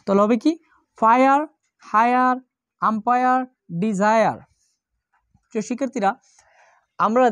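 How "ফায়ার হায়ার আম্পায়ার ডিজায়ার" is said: ফায়ার, হায়ার, আম্পায়ার, ডিজায়ার each ending in a loud "-aya"; "ire" as aya. Shown as a demonstration. Teacher drilling students on the "ire" sound, ফায়ার to ডিজায়ার said as "-aya". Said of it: "আয়ার মতো".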